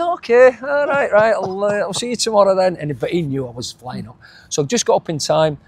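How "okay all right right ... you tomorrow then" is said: high-pitched, casual retold sign-off; imitated breezy phone goodbye, higher than usual; agent playing along. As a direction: act out a knowing agent signing off, pitched up. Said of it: "He says".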